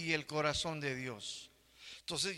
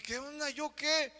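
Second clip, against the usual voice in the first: higher, protesting innocence; defensive; 9 semitones higher than usual. Retold or imitated. Wife blamed for the ruined suit, higher protest. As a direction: toss back a high what, me?.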